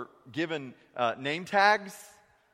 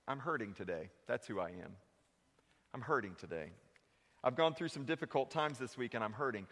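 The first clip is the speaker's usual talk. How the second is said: quiet confession; 4 semitones lower, 7 dB quieter than usual. Acted. Preacher voicing a hurting churchgoer, low and soft. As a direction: lower and softer, heavy with hurt. Honest confession voiced for congregant.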